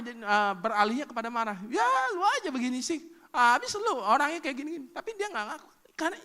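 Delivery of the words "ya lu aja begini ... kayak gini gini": higher, louder accusing tone; mock angry blame. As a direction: turn the jealousy into a snappy blame. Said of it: "Paling kan dia marah". (pasangan cemburu menyalahkan dengan nada marah)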